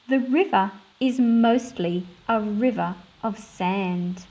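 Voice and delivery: put-on measured read-aloud storytelling for children; story narration. Teacher reading a picture book to her class, storytelling voice.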